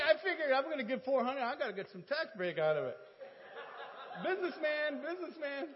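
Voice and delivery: high, loud mock self-justification; loud joking boast. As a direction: crow it like a proud businessman.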